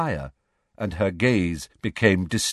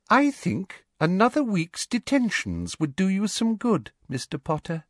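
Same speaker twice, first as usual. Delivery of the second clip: sleek; 11 semitones higher than usual. A narrator's reading. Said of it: "said Umbridge sleekly".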